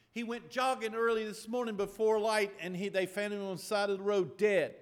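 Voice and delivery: loud, high, urgent telling; retold upset daughter. Daughter telling father how he was found, loud. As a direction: rush out the news in distress. Daughter voiced explaining on phone.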